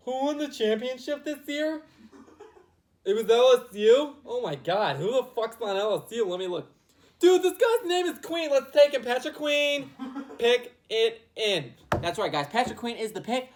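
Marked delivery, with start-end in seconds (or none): 0.1-11.7 excited, dim-witted team brass; loud, slow, goofy voice of clueless scouts; Lions front office. Retold or imitated. Lions front office excitedly picking a player with a cool name. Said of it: "They're probably like".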